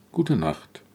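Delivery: viel leiser, müde nachgesprochen; leises Echo. Acted. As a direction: gute Nacht fast verklingen lassen. Leise zweite Antwort. (leise gute Nacht, wie schon halb im Schlaf)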